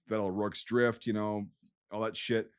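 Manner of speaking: normal talking voice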